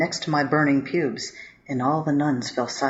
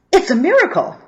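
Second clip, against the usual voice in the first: higher and louder, bursting with wonder; excited; 11 semitones higher, 8 dB louder than usual. Acted. Boyfriend proclaiming the miracle to the priest, excited and loud. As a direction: proclaim it like a revelation.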